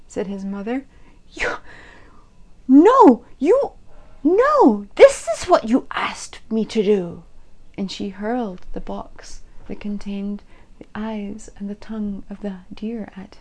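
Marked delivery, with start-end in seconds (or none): 1.3-7.2 1 sputtering rage. A narrator's reading.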